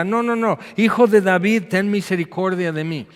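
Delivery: desperate cry. Acted. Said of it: "Y él está".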